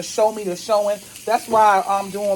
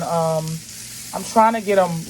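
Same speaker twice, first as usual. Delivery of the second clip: normal talking voice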